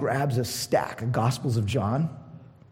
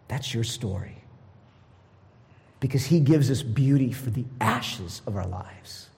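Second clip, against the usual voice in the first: ordinary talk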